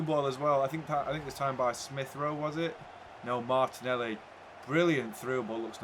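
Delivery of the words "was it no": ordinary delivery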